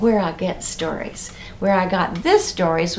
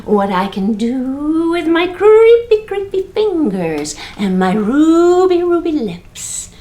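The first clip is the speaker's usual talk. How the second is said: creepy ghost; 5 semitones higher, 4 dB louder, slower than usual. Acted. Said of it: "the greenish whitish ghost said".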